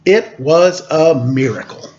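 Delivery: ordinary delivery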